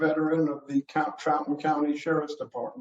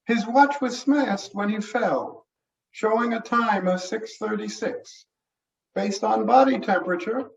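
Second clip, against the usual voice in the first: higher-pitched, matter-of-fact examiner; explaining medical examiner; 6 semitones higher than usual. Acted. Examiner explaining the smashed watch to the sheriff, high matter-of-fact voice. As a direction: reason it out in a raised character voice.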